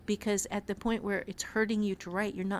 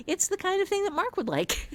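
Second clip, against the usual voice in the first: high, laughing through the words; 11 semitones higher than usual. Real, not acted. Laughing with her cohost about Mark's taste, high and giggly. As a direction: giggle it out, amused.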